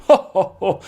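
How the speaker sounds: hammy "ho ho" villain laugh; high, put-on count's chuckle; mock villain laugh. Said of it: "And he talks like this".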